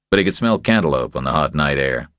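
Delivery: ordinary delivery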